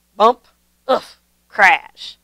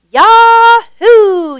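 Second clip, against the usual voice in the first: much higher, loud whooping yell; joyful yell; 13 semitones higher, 11 dB louder than usual. A narrator's reading. Nikki whooping as the toboggan speeds downhill, loud and high. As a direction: whoop with delight as the sled takes off. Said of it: "yelled Nikki".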